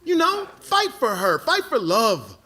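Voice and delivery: high-pitched, fervent self-urging; rally yourself like you're going into battle; rousing pep talk. Retold or imitated. Man urging himself to win her back, rousing pep talk.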